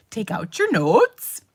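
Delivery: loud, drawn-out exaggerated Minnesotan "notes"; mock Minnesotan accent. Students teasing their teacher, mock Minnesotan accent. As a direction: mimic a Minnesotan accent, slow and overdone. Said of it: "I did not talk that way".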